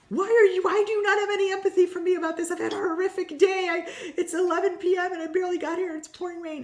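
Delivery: high, loud, fast frantic pleading; hammed-up panicked guest venting at staff; frantic complaint. Guest at the retreat desk pleading with calm staff, frantic and loud.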